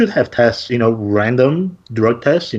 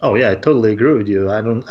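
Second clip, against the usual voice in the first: usual speaking voice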